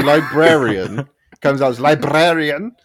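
librarian said with the r rolled; rolled r's. Retold or imitated. Mocking the audiobook's rolled r in librarian. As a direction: roll the r in librarian hard. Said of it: "he always rolls his R's".

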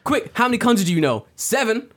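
fast, high-pitched interrogation; quick mock grilling; gatekeeper cop. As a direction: fire questions like a self-important officer. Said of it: "how I see gatekeepers in Japan".

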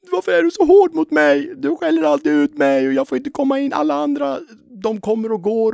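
put-on a hurt teen near tears, pleading; tearful. Teenager pleading with his strict teacher, near tears. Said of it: "tårar i ögonen".

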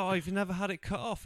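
high, fast put-on surprise; mock surprised woman. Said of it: "And she's like".

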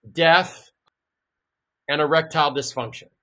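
normal talking voice

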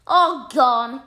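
very loud, high exclamation; shocked cry. A narrator's reading. Mouse cries out at the third name, shocked. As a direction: burst out with the name in disbelief. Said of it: "cried the mouse".

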